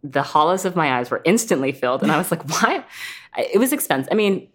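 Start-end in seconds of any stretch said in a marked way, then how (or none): 2.5-2.8 high incredulous why; mock disbelief. Retelling the filler results to a friend, high disbelieving why. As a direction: squeak out a baffled why.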